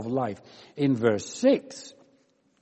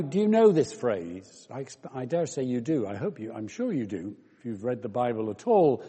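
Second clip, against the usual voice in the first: normal talking voice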